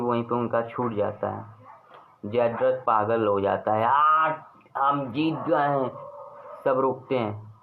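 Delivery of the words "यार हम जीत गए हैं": gloating Jayadrath. Acted. Jayadrath gloats thinking he won.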